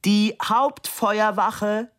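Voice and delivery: slow, high shout; drawn-out high-pitched call. A narrator's reading. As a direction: shout it slowly for someone hard of hearing. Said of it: "schrie Fabian".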